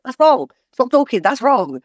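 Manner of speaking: indignant heckling. Retold or imitated. Mocks hyper-focused audience members, high indignant heckle. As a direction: cut the speaker off sharply, mocking the nitpicker. Critics in audience correcting speaker.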